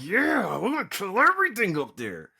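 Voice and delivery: high, wild rallying yell; over-the-top high-pitched war whoop; hammed-up battle cry. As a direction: whoop it like firing up troops. Yeah, that was weird.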